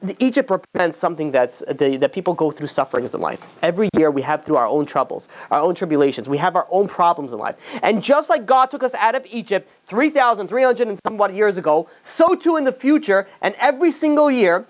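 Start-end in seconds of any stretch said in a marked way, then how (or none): 0.0-0.5 raised, ringing sermon voice; preaching. Acted. Rabbi to the congregation about Egypt, preaching high and full. 7.8-14.7 raised, ringing sermon voice; preaching. Acted. Rabbi to the congregation about Egypt, preaching high and full.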